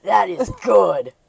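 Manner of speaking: slow, loud, awestruck "Holy shit"; hammed-up eighties crowd amazement; mock amazed. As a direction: gush in exaggerated wonder. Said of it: "be like".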